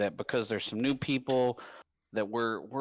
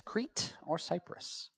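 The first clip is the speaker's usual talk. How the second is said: whispered quiz question; whispering; 6 semitones higher, 6 dB quieter, much slower than usual. Shown as a demonstration. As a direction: repeat it in a soft whisper.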